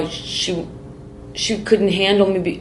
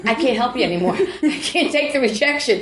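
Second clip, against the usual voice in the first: imitating friend; 6 semitones higher than usual. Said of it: "she goes".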